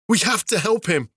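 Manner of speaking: high, urgent plea; desperate cry. Acted. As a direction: beg them to help. Varin pleads desperately.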